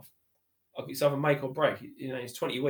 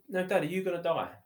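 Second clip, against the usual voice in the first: put-on higher child voice, insistent; a hint of a small boy pressing; little son's voice; 7 semitones higher than usual. Son repeating his question to dad, in a higher child voice. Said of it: "He said".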